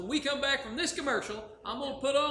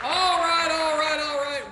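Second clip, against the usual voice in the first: big booming TV host opener, higher and louder; loud host welcome; 6 semitones higher, 10 dB louder, slower than usual. Acted. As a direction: pump up the studio crowd. Host greets audience after break.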